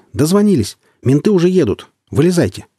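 high excited call from above; anxiously joyful shout. Acted. Voice from above calls the men, anxiously joyful. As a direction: call down, anxious but glad. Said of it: "голос тревожно радостный".

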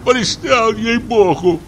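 high, whining irritation; pained annoyance. A narrator's reading. Forester fends off the nagging hunter, high whining voice. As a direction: whine at being pestered. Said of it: "поморщился лесник".

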